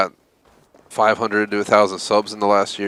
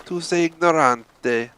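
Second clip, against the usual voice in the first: slow, higher Italian line "Tu sei ignorante" with a rolled dramatic lilt; Ezio's Italian; 8 semitones higher, slower than usual. Retold or imitated. Gamer quoting Ezio Auditore to ignorant people, slow Italian. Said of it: "As Ezio Auditore would have said".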